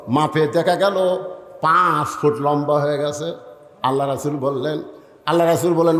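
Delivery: ordinary talk, nothing marked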